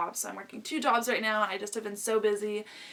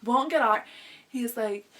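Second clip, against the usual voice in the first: ordinary talk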